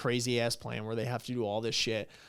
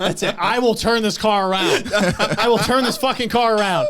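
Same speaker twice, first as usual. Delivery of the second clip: loud, high mock parental threat; fed-up parent; 7 semitones higher, 8 dB louder than usual. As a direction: threaten the back seat at full exasperation. Mimics fed-up parent threatening kids.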